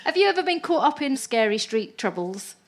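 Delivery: ordinary talk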